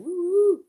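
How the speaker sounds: loud high cheer; excited woo. Real, not acted. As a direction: let out a big whoop. Cheering at chat.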